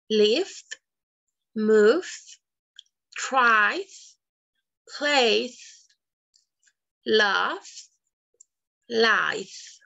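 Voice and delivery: each word said alone, ending rising; D ending list. Shown as a demonstration. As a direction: model the voiced endings slowly. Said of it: "se les va a pronunciar con D". (cada palabra con final sonoro)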